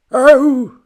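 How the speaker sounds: put-on high, loud strained old man; a failed old man try; bad old man voice. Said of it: "No, that's horrible".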